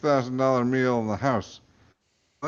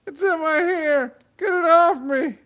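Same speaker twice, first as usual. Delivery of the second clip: panicked shriek; 5 dB louder than usual. Acted. Bella panicking.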